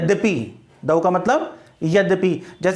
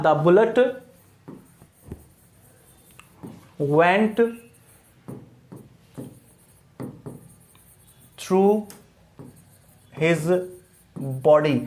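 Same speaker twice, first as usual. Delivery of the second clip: isolated words, long silences between them; dictation with long pauses; 3 semitones lower, much slower than usual. Shown as a demonstration. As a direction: dictate as if writing each word on the board. Dictates sentence while writing it.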